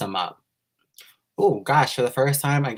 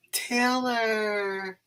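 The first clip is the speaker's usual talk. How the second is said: gasp, then a high breathy name; surprised gasp before the name; 11 semitones higher than usual. Real, not acted. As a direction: caught off guard by a kind message. Sees a fan's message.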